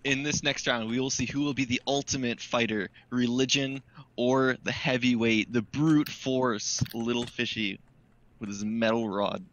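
slow, dramatic announcer build-up; fight announcer. Acted. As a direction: draw out each fighter's name.

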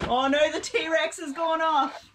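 high, loud playful outcry; noisy mock alarm. Packer warns partner the T-Rex has gone off, mock alarm. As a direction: cry out in pretend panic, high and loud. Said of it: "You're very noisy".

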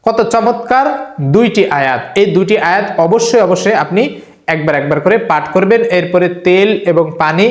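ordinary talk

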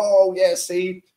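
high, loud sarcastic crowing; smug i-told-you-so fans, hammed up; mock told-you-so. Mimicking gloating fans who finally see him score, mock smug. Mocking fans after a goal.

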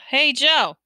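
high, loud hail; calling out. A narrator's reading. Attendant to the ambulance driver, calling out. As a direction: holler to the front. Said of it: "the attendant called to the driver".

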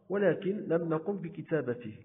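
ordinary talk, nothing marked

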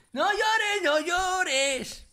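high, loud wailed comfort; mock consoling. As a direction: console a crybaby with hammed-up pleading. Mock consoling the crying friend.

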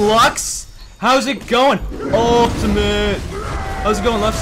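high, loud, gloating hail; taunting greeting. Real, not acted. Player attacking Lux, greeting her in a loud taunt. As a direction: greet your victim as you pounce.

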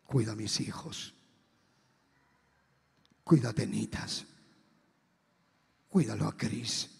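very low, heavy farewell plea; despedida solemne. Retold or imitated. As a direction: entrust your children, low and with long pauses. (encargo grave, en voz muy baja)

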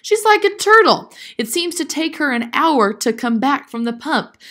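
loud, high-pitched grumbling exclamation; exasperated cry. A narrator's reading. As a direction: cry out the complaint, pitch jumping up. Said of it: "cried Grandfather".

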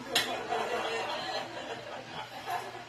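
a real high burst of laughter. Real, not acted. Laughing at the wife's quip.

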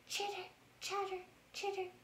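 high, soft, quick chittering; squirrel chatter. Acted. Squirrel chatters at the bear on his nest.

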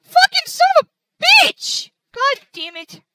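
high, loud, drawn-out furious swearing; shouted in real frustration; real angry outburst. Real, not acted. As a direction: explode with rage, stretching each word. Capture froze, player curses in frustration.

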